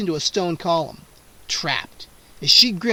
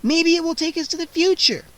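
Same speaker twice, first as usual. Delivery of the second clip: loud high urgent shout; urgent cry; 11 semitones higher, 4 dB louder than usual. A narrator's reading. Scene: Kara shouts to Freddy mid-attack, loud urgent cry. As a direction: call out the idea fast.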